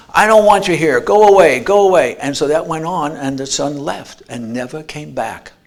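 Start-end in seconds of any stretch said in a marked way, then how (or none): 0.1-2.2 loud, higher yell to go away; furious shout. Retold or imitated. Father yelling at his son to leave. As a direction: slam the door with your voice.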